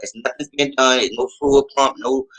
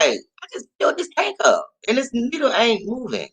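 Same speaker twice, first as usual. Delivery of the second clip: puzzled complaint; 9 semitones higher than usual. Retold or imitated. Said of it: "And I'm like".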